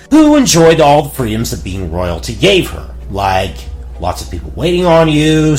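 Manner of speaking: plain reading voice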